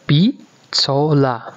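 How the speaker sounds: Bitsola stretched out slowly, syllable by syllable; slow model. Shown as a demonstration. Teacher slowing the word for aunt-by-marriage, drawn out. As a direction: spell out Bitsola slowly for learners. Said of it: "wife of our uncle would be".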